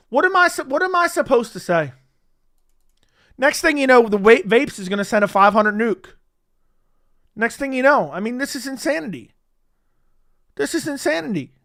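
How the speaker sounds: excited disbelief. Real, not acted. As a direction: marvel at the donation flood. Overwhelmed by donations.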